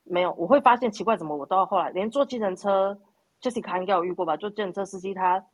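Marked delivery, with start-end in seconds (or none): none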